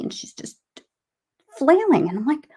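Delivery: ordinary talk, nothing marked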